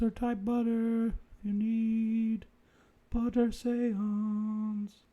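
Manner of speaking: slow, high, drawn-out summoning chant; mock seance incantation. Host summoning dead butter man with viewers, chanting slowly. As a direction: intone it like a hokey medium, stretching words.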